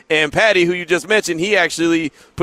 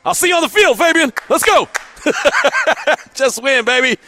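calling out; 8 semitones higher than usual. Real, not acted. Host calling after player Fabian as he heads out, higher excited shout. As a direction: hype him up as he leaves.

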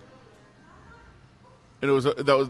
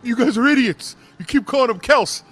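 high, loud mock angry caller; 10 semitones higher, 5 dB louder than usual. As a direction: bark it like an irate caller phoning in. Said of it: "a caller or something that calls".

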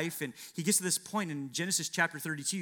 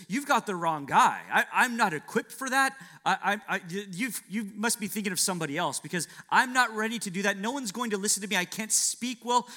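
nervous excuses; 5 semitones higher, 5 dB louder than usual. Acted. Moses makes excuses to God.

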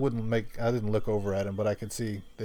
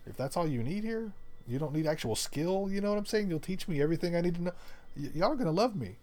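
higher, eager sales pitch of himself; cocky and eager; 7 semitones higher than usual. Retold or imitated. Pitching himself to the new boss, higher and eager. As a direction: sell yourself, brimming with confidence.

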